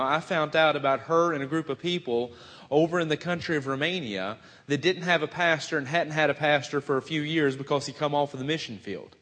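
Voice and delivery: usual speaking voice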